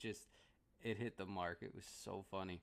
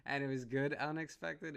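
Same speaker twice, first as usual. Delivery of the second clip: excited; 7 semitones higher, 6 dB louder than usual. Real, not acted. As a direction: gush about how surprising it was. Excited recap after the laugh.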